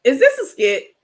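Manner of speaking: high, loud disbelieving question; surprised question. Real, not acted. Asking chat if it's a skit, surprised. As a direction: ask it in real disbelief.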